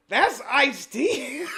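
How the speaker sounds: slow, high, loud yell of the text; laughing mock yell; all-caps shout. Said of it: "in all caps".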